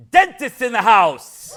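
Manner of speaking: loud rallying shout to the room; crowd call-out. Real, not acted. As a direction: call them out so they cheer. Rallying audience from the stage.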